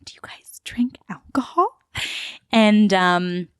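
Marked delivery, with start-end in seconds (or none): 0.0-1.7 high, breathless whisper of disbelief; mock-naive whispered question; shocked whisper. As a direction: whisper it like a scandalized innocent. Acting her sheltered, shocked younger self.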